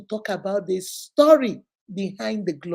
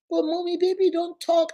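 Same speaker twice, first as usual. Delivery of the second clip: put-on high, disappointed little girl; sad child voice; 8 semitones higher than usual. Toddler sadly protesting to her mommy, high child voice. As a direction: whine like a let-down two-year-old. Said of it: "she was so sad".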